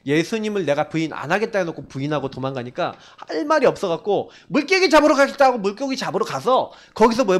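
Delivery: normal talking voice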